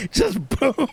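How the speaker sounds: laughing through the words; giggly, high and breathless. Real, not acted. Laughing while telling the story.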